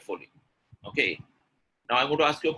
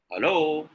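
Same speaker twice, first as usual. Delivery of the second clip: HELLO swooping up on the second syllable; lengthened hello for contrast; intonation demo; 3 semitones higher, 4 dB louder than usual. Shown as a demonstration. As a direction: lift the end of HELLO and hold it. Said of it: "Do you think the meaning is changing?".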